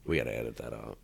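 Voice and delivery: ordinary delivery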